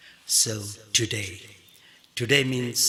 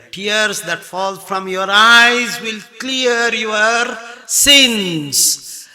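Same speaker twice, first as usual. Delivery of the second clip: fervent preaching; 9 semitones higher, 9 dB louder, much slower than usual. Acted. Preacher urging repentance.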